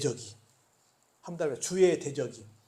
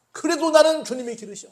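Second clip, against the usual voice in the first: exclaimed confession; 8 semitones higher, 7 dB louder than usual. Acted. Preacher cries out the confession.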